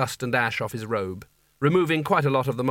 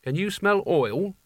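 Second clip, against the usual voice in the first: slow, higher, wary question; suspicious; 6 semitones higher, slower than usual. Acted. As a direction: sniff out trouble, slowly. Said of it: "she added suspiciously".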